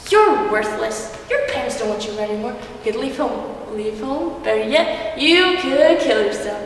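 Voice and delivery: a hint of coaxing menace; lightly voiced cruel voices. A narrator's reading. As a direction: tempt her, then bark the last word. Voices urging suicide in forest.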